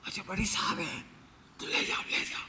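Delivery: soft, almost whispered coaxing; girl's hushed voice. Retold or imitated. Girl quietly telling the inspector to take the canes, soft hush. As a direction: hush it, let the big man take it. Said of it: "अब जिस style से बोल रही थी".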